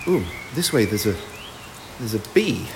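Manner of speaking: low, soft, wary discovery; uneasy hush. Acted. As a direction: say it carefully, under your breath. Spots a bee up the tree.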